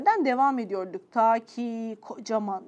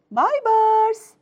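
high, loud motherly call; sudden raised-voice summons; 9 semitones higher, 6 dB louder than usual. Acted. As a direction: call the child from another room. Mother calls her son.

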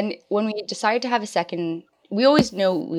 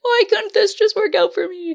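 much higher self-pitying whine; 14 semitones higher, 3 dB louder than usual. Retold or imitated. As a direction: plead to the universe.

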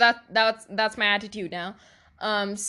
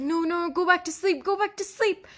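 high-pitched mock pleading; pleading refusal; 6 semitones higher than usual. Re-enacting waking up depressed, pleading to sleep on. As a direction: beg yourself back to sleep, voice pitched up.

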